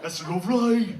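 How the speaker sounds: badly imitated Brummie accent; an American's shaky attempt at Brummie; terrible Birmingham accent. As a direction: have a clumsy go at a Birmingham accent. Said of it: "I'm working on the accent".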